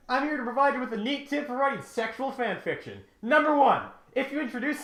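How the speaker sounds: high, loud hyped-up vlogger voice; loud, high cartoon host yelling tips; hyped YouTuber Kevin. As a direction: shout it like an overexcited YouTuber intro. Kevin character bit, hyped.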